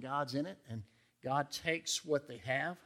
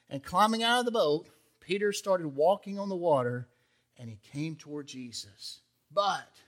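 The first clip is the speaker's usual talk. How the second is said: ordinary talk, nothing marked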